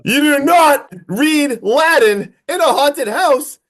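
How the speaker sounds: high, loud and slow, every word hammered; a shocked, drawn-out outburst; emphatic exclamation. Real, not acted. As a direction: scold them like it's the first rule of horror. Scolding friend about horror rules.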